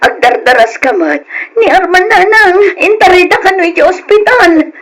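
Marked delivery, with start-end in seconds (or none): 1.6-4.7 high, loud, tearful rush; distressed crying news. Acted. Daughter telling mother Arman is in hospital, high tearful panic. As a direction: blurt the bad news through tears.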